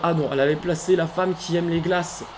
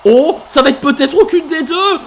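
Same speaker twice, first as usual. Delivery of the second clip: louder, higher hyped calls; rising excitement; 5 semitones higher, 8 dB louder than usual. Real, not acted. Commentator hyping up the final ladder duel, excited. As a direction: build the tension of the duel.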